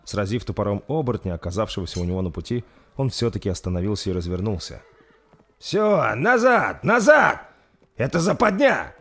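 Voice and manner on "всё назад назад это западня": high, loud panicked shout; shouted retreat order. Acted. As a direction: scream it, rising with each назад. Commander calls retreat in battle.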